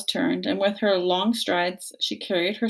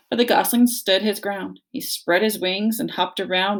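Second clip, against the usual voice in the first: plain reading voice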